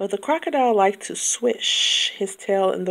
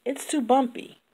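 higher, complaining little ladybug; a small bug fretting, pitched up; 6 semitones higher than usual. A narrator's reading. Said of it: "cried the lazy ladybug".

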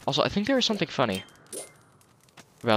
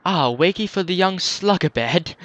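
put-on Beetle's posh English accent, lightly done; 3 semitones higher, 3 dB louder than usual. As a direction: roll out slugabed in a mild English accent. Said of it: "I gave him an English accent".

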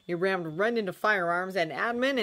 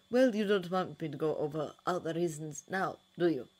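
phlegmatic. A narrator's reading. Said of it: "said Mycroft phlegmatically".

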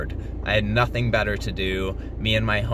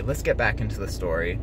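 ordinary talk

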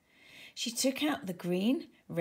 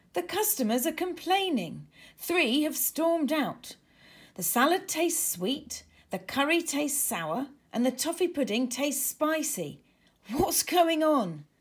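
raised, urgent report; agitated complaint; 6 semitones higher, 4 dB louder than usual. Acted. Waiter rushing back to the chefs, agitated. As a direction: burst in with bad news.

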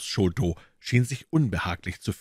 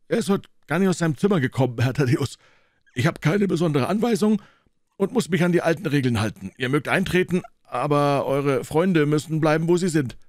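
high, loud stubborn porter voice; a guard who won't budge; gruff gatekeeper; 9 semitones higher, 4 dB louder, faster than usual. Acted. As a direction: doorman refusing, loud and high. McMurdo insists on rules.

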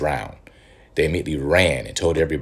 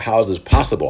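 mock disbelief; 6 semitones higher, 6 dB louder than usual. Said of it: "and I was like".

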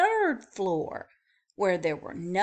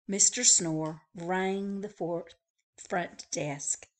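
usual speaking voice

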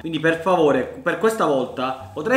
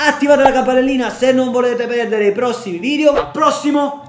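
YouTuber outro; 7 semitones higher, 5 dB louder than usual. Acted.

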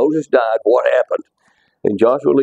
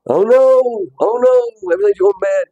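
mock panic; 10 semitones higher than usual. Teacher acting out a panicked person, mock dismay. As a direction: act out being dismayed and frightened.

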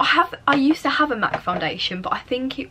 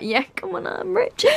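higher, smug mock bragging; mock show-off; 9 semitones higher than usual. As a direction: play a pretend rich show-off. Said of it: "trying to be like".

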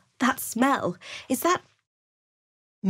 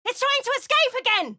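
high urgent yell that it's escaping; alarmed shout; 13 semitones higher than usual. Acted. Companion alerting the party, alarmed high shout. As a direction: shout the warning to the whole party.

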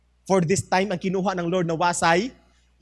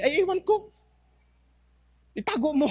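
puzzled husband; 9 semitones higher than usual. Acted. Husband answers wife about gold.

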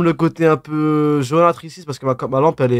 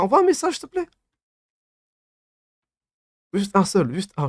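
suppliant; 6 semitones higher than usual. Real, not acted. Implores chatter to send message.

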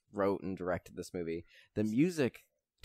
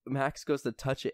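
ordinary delivery

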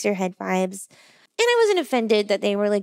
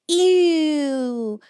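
mock disgust; 4 semitones higher, 4 dB louder than usual. Strangers react to the meat pie.

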